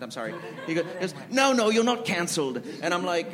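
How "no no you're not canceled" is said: higher-pitched mock British reassurance; badly faked British executive; attempted British accent. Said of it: "he's British. I can't do his accent".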